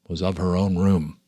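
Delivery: plain reading voice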